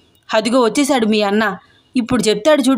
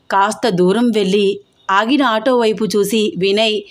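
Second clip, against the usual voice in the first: plain reading voice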